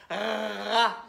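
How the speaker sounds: "Ra" with a long drawn-out R before the a; stressed first sound. Shown as a demonstration. Teacher asking what starts the syllable, exaggerated R. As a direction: hold the R of "Ra", then open into the vowel. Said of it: "J'entends quoi au début?".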